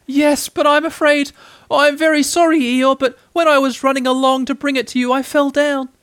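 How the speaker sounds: high, loud little voice; Piglet, anxious apology. A narrator's reading. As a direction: confess it in a high, worried pitch.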